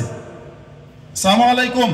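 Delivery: "আসসালামু আলাইকুম" as slow, high "saamu alaikum"; laam dropped. Shown as a demonstration. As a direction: say "আসসালামু আলাইকুম" slowly without its "la". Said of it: "লাম অক্ষর বাদ দিলেইছে".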